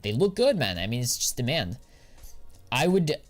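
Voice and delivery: normal talking voice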